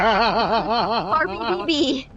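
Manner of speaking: slow words through laughter; laughing. Real, not acted. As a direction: giggle it out slowly. Laughing while reading chat name.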